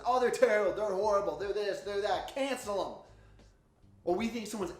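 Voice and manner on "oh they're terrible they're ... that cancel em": loud, higher contemptuous rant; mock hateful rant. Crowd trashing someone they cancel, mock hateful rant. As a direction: spit out the insults. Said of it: "by saying hateful things".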